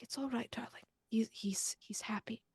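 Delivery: soft unvoiced whisper; whispered comfort. Doctor [name] whispers to comfort Penelope, pulling her back. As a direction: hush her gently, whispering.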